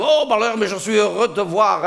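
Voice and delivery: high, loud mock welcome; imitated effusive host; delighted greeting. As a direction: greet a visitor with hammed-up delight. Acts the delighted Belgian host.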